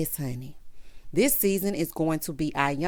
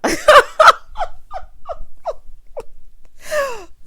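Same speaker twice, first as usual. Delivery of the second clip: a long, high, loud real shriek of laughter; 9 dB louder than usual. Real, not acted. Reacting to the scarecrow insult with a real loud shriek of laughter.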